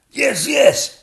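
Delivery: high, loud agreeing king; excited king yelling. Acted. King backing the princess against the dragon, high and loud. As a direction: burst in eagerly. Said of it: "cried the king".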